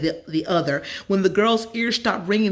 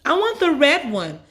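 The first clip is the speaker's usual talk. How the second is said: put-on high, bright little girl asking; a kid who wants the green one; high excited child voice; eager girl; 9 semitones higher, 5 dB louder than usual.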